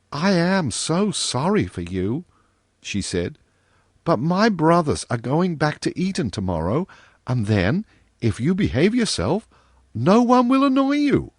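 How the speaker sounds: a hint of a kind little girl; young girl voice. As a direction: gently comfort him as a young girl. Virginia's dialogue in the story.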